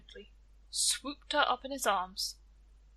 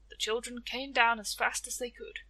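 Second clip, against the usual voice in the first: plain reading voice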